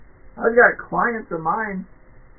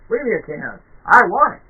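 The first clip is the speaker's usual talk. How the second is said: demanding customer; 4 semitones higher, 9 dB louder, faster than usual. Pretend.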